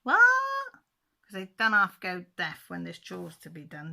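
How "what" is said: much higher, loud squawked What; feigned deafness. Pretend. Husband pretending not to hear his wife, squawking. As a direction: play deaf like a husband dodging chores. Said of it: "And he's going".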